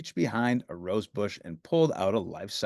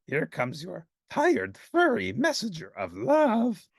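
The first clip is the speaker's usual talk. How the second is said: Grover voice; 8 semitones higher, slower than usual. Acted. Grover tired.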